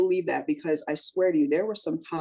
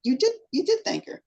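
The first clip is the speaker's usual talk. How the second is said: higher, quick, insisting; insistent interviewer; 11 semitones higher, faster than usual. Retold or imitated. Interviewer telling the actress she did thank her mom, insistent. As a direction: correct her kindly but firmly. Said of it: "the interviewer said".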